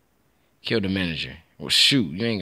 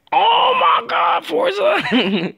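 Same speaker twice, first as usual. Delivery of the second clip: much higher, louder, slow drawn-out yell; excited shout; 6 dB louder, much slower than usual. Real, not acted. Gamer hyped at the screen, high drawn-out shout.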